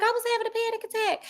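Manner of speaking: high, fast, soft; quoting Vea. Retold or imitated. Vea explaining herself to Sonny, high and fast. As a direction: rush it out high and anxious. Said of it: "And she's like".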